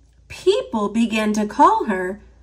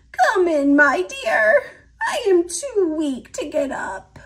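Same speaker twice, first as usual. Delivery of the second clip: put-on higher, weak old grandma voice; frail grandma; 7 semitones higher than usual. A narrator's reading. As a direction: sound too sick to stand. Sick grandma invites her in.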